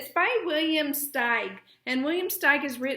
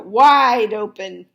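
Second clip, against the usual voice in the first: loud, slow drawn-out order; yell; 3 semitones lower, 10 dB louder, slower than usual. A narrator's reading. Wife yelling to the fox mid-work. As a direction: yell it out long. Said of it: "yelled his wife".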